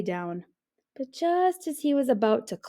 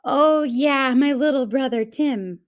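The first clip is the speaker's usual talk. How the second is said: a hint of a grumble, louder; grumbled; 5 semitones higher, 8 dB louder than usual. A narrator's reading. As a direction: grudgingly admit it. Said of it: "grumbled Jim".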